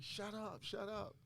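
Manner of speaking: high-pitched mock hush; urgent hushing. Friends hushing him in retold panic.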